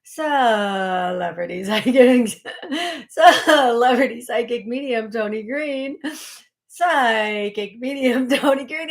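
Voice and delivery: slow, loud, stretched-out host intro broken by laughs; mock show intro. Friend [name] teasing how she says her show name, hammed-up announcer voice. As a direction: ham up the show title like a cheesy announcer, laughing through it. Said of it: "He kept going".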